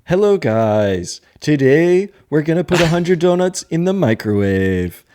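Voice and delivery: put-on laughing through a TikTok accent. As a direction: mimic a YouTuber's TikTok accent intro. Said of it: "I picture the".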